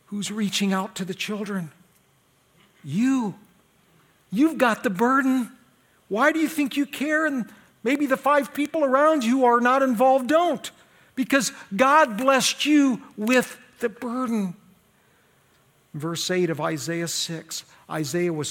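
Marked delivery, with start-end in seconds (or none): 2.9-14.6 high, insistent pulpit challenge; forceful preaching. Real, not acted. Preacher telling the one who cares for children, insistent challenge. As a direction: press it on the listener, slowing into the last phrase.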